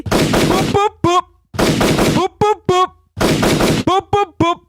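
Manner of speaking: high rapid boops; vocal horn volley. Pretend. Hype man salutes the show, high horn volley. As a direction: fire off horn noises in threes.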